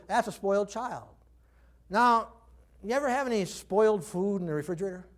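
usual speaking voice

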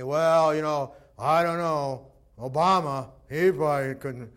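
hammed-up doubter; doubting listener. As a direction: voice someone's reluctant doubt.

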